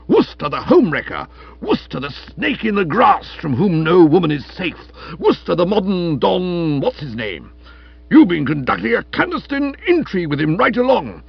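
high, loud jealous tirade; furious denunciation. Acted. Stilton denouncing his rival to Florence, ranting loudly. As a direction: pile up accusations with mounting outrage.

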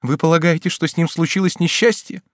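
high, anxious mother's question; alarmed question. A narrator's reading. Mother fears for missing son. (встревоженный вопрос высоким голосом)

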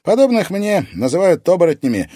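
put-on high, loud teenage boy; bright, confident boy; boyish character voice. A narrator's reading. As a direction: announce it in a ringing lad's voice. Boy's voice reveals what he is.

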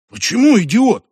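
wounded, rising question; offended. A narrator's reading. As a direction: protest the insult, hurt and indignant. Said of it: "обиделся религиозный".

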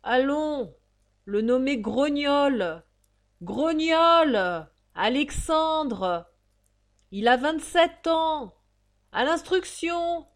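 high, loud, drawn-out crier's call; town-crier bark with stretched vowels; drawling roll-call shout. Acted. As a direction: bellow each name slowly to a crowd. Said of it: "appelait d'une voix traînante".

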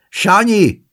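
loud high call for her grandson. A narrator's reading. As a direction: shout the name across the flat. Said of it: "kiáltott az öregasszony".

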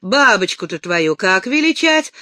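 usual speaking voice

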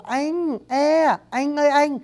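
"anh ơi anh" high and slow, with a clear e vowel; Northern pronunciation. Shown as a demonstration. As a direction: isolate the e, then say "anh" as e-nh. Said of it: "đọc là anh".

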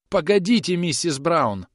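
high, loud call after her; desperate cry. A narrator's reading. Groom calls departing old woman back, desperate. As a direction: call out in despair. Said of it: "закричал с отчаянием точильщик".

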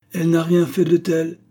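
loud, high interjection; spirited. A narrator's reading. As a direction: jump in briskly to defend her. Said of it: "intervint Agar avec entrain".

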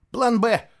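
loud, high, triumphant announcement; beaming. A narrator's reading. Boy reveals his backup plan, beaming. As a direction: announce it proudly and brightly. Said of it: "сияя, сообщил Фло".

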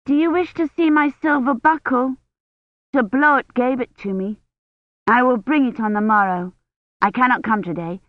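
lightly voiced little girl with old-fashioned speech; young Agnes. A narrator's reading. Little girl offering to show her silver buckle, eager child's voice.

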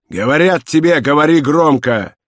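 high, insistent angry order; loud command. A narrator's reading. Inspector orders prisoner to speak up, loud command. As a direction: insist forcefully, raising the voice. Inspector demands louder speech.

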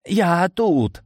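high-pitched wail of a wounded wizard; howled cry. A narrator's reading. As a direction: howl out to be found. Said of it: "взвыл волшебный террорист". (высокий жалобный вой)

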